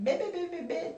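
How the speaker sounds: high little burst of mock chatter; mimicked yapping. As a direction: imitate a woman yapping away. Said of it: "she's still like this".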